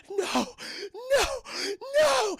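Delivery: gasping, very high, loud cries; imitated panicked screaming, with pauses; acted terror. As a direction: gasp before crying out in horror. Acting Sarah terrified at seeing Terminator.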